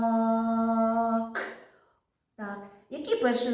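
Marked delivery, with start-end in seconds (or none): 0.1-1.4 «Мак» drawn out loud, each sound held; every sound distinct. Shown as a demonstration. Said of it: "щоб чутно було кожен звук". («Мак» протяжно, чути кожен звук)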